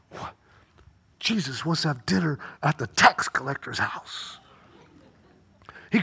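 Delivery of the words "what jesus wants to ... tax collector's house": lower, softer, astonished murmur; stunned disbelief. Retold or imitated. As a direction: say it as if you can't believe it. Zacchaeus reacts in disbelief.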